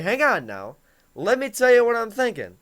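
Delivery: put-on engaged answer to an angry girlfriend. Shown as a demonstration. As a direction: lean in and jump into the conversation. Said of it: "You gotta be engaged in the conversation".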